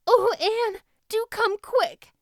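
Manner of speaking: high, slow frightened plea; nervous pleading. A narrator's reading. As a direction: beg her to hurry, scared. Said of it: "implored Diana nervously".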